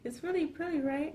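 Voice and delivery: high, soft girlish imitation; hammed-up coy girlfriend voice; movie girlfriend. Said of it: "and she's like".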